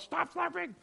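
high-pitched, loud mock complaint; imitating a squabble at the table; mock scolding. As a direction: snap it like a fed-up diner. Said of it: "telling somebody else".

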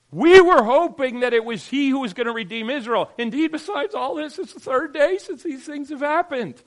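loud, high cry of dashed hope; the disciples on the road, shouting their disappointment; disciples' loud lament. Acted. Voicing disciples again.

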